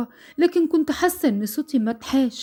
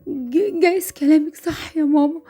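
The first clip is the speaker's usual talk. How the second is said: higher, choked, tearful protest; choked with fear; 5 semitones higher than usual. Acted. Frightened daughter answers her mother, choked and higher with fear. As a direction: push the words through a tight throat. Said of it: "بصوت مخنوق بسبب الخوف والانفعال".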